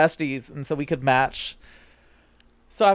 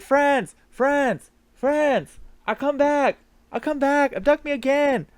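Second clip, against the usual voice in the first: much higher, louder call as if through a megaphone; megaphone announcement; 11 semitones higher, 4 dB louder, slower than usual. Pretend. Floating player calls to friends through megaphone, mock triumphant. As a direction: boom it out through a megaphone to everyone. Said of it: "So I'm like, I'm like".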